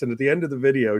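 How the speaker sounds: ordinary talk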